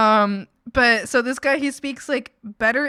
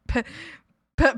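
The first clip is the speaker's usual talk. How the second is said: usual speaking voice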